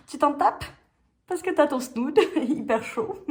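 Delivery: can't stop giggling mid-sentence. Real, not acted. [name] bragging about her warm snood while giggling.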